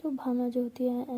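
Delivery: usual speaking voice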